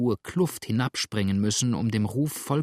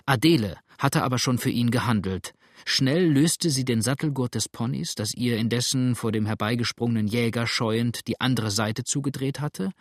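ordinary delivery

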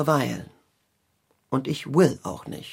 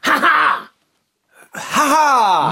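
loud, high, furious cackle; raging villain laugh; 11 semitones higher, 7 dB louder, slower than usual. Shown as a demonstration. [name] demonstrates Tybalt's wrathful laugh for Conny. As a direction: laugh with full fury and mission. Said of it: "mit mächtiger Wut".

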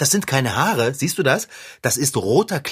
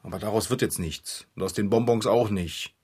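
sad; 6 semitones lower, 6 dB quieter than usual. Acted. Clown sad about losing.

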